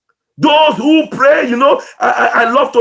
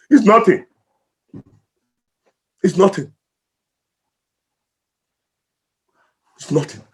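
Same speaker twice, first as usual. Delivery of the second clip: emotional; 6 semitones lower, 3 dB quieter, faster than usual. Real, not acted. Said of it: "Sorry, I'm emotional about it".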